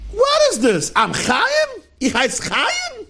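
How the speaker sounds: high-pitched incredulous father; dragging the last question out, high and aghast; outraged disbelief. Retold or imitated. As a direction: squeal the questions in mock indignation. Acts father outraged at first name.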